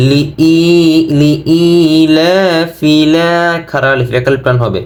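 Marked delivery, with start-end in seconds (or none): 0.1-2.9 "লিইলাফি" chanted slowly with the ই held long; slow model recitation of "লিইলাফি", ই stretched; correct tajweed pronunciation. Shown as a demonstration. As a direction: draw out the long vowels in "লিইলাফি". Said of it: "এখানে কিন্তু সঠিক উচ্চারণ".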